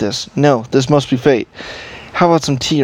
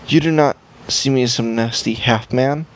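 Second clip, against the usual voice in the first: ordinary delivery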